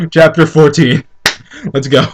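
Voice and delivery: usual speaking voice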